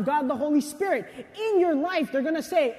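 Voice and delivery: ordinary talk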